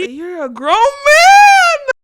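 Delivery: high, loud, drawn-out wail of disbelief; stretched, high, loud disbelief at the clip; shocked outcry. Real, not acted. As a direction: cry it out slowly at a grown man barking.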